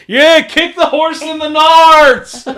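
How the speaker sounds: high, loud whooping cheer, breaking into laughter; rowdy yell. Acted. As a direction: holler it out like a rowdy heckler. Acting out a drunken cheer.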